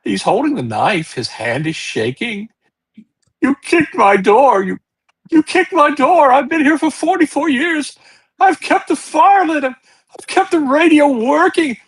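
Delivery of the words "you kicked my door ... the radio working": put-on high, loud, sobbing old man pleading; a terrified old man wailing through tears; old hermit's voice cracking as he cries and shouts; crying old man. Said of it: "old man voice and a guy crying like crazy".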